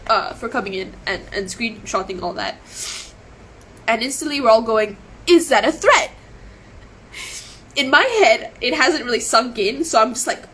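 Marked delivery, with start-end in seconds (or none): 5.3-6.1 higher, louder retold challenge; indignant challenge. Friends in chat all react to his warning, indignant. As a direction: throw it back as an affronted question.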